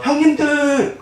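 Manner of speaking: high, mock wail to his brothers; wailing cry. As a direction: cry out after them as you're dragged away. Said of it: "울부짖었을까요".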